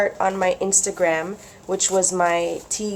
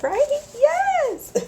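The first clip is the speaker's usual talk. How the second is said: much higher squealed yes; excited squeal. Real, not acted. Vlogger inviting viewers to journal along, high squeal. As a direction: squeal it with glee. Excited about joining together.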